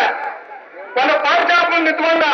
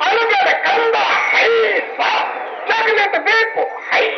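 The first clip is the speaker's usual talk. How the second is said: heave-ho hushing; 6 semitones higher, slower than usual. Retold or imitated. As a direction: hush the baby like a lifting call, raised high. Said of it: "ಹೈಸ ಅಂದ್ ರೂಢಿ ಅವ್ರು".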